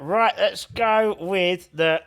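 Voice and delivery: talking with a penny in mouth; words round a coin in mouth. Real, not acted. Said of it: "I've got a penny in my mouth".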